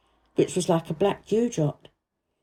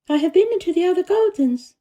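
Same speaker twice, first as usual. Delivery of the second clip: put-on lightly higher girl's voice, earnest; a girl telling the gardener about the secret garden, higher voiced; young Mary voice; 10 semitones higher, 4 dB louder, faster than usual. A narrator's reading. Mary's voice in the story.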